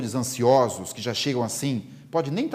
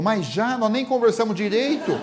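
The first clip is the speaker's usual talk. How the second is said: laughing protest; 7 semitones higher than usual. Acted. Friend protesting Divaldo leaving early.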